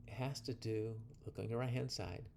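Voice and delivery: ordinary talk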